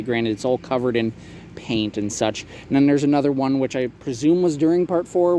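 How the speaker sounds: ordinary delivery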